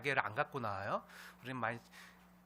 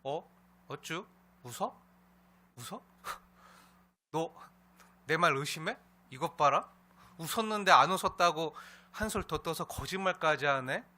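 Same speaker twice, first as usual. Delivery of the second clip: indignant rebuke, building louder; mock scolding; 2 semitones higher, 6 dB louder than usual. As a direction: act out an affronted telling-off. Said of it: "이렇게 사라에게 책망하는 장면인가요?". (따져 묻듯 책망하는 연기)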